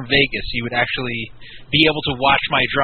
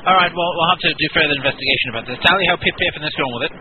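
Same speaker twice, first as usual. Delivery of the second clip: put-on a lightly done English accent as an old army colonel; British colonel accent. Prank caller ringing the casino as an English colonel, light British accent. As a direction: play the stiff English colonel, barely put on.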